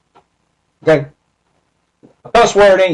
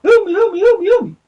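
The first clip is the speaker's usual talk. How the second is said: much higher, pleading voice of a stuck man; stuck addict begging; faster than usual. Retold or imitated. Retelling the stuck man in the alley begging for help, high pleading whine. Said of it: "talking about".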